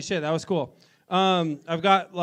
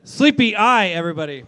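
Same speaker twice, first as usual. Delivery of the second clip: calling out; 3 semitones higher, 7 dB louder than usual. Real, not acted. MC introducing the band.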